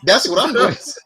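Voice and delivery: high, laughing defiance; cracking up mid-line; laughing explanation. Retold or imitated. The boy defending his pronoun to parents, laughing defiance. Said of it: "He said".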